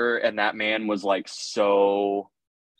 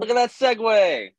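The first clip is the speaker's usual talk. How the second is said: teasing; 12 semitones higher than usual. Real, not acted. Teasing cohost.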